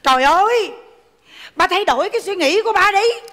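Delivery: high-pitched, worked-up pleading; acted daughter's frustrated protest; exasperated outburst. Daughter exasperated, confronts father in sketch.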